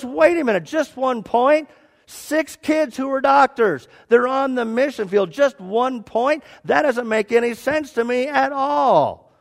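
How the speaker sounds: high, loud acted disbelief; indignant protest. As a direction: argue hotly over an unfair score. Man acted protesting his score.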